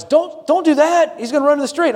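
high-pitched mock worried wife; imitating the wife. Husband quotes his wife warning about their son, mock worried. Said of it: "my wife says".

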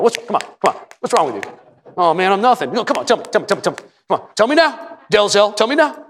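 pastor coaxing. Retold or imitated. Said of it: "You go like that".

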